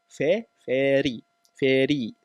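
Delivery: normal talking voice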